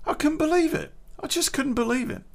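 disbelief. Real, not acted. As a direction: still can't believe it, voice jumps high. Retelling girlfriend's betrayal.

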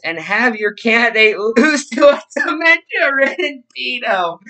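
cracking up, pitch climbing high; laughing while reading. Real, not acted. Streamer reading a gloating post to viewers, cracking up. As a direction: read it out through real laughter.

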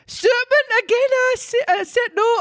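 very high falsetto pigeon; agitated pigeon. Acted. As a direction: squawk it as a comic Geordie pigeon.

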